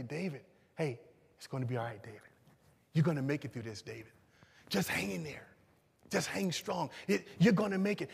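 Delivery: soft, low consoling voice; gentle encouragement. Acted. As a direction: reassure a discouraged brother quietly. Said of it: "pat David on the back and say".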